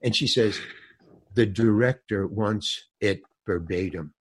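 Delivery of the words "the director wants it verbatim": low, softer, adamant insistence. Retold or imitated. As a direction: lay down the rule quietly and firmly. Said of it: "she was very adamant about that".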